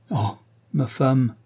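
slow, drawn-out lament; mild anguished exclamation, read with restraint; tearful cry. A narrator's reading. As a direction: grieve gently over the words. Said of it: "s'écria Lord Lynch en versant quelques larmes".

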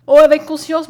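loud, high burst of eagerness; eager joy. A narrator's reading. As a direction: blurt it out happily. Mariette begs to dine with Conscience.

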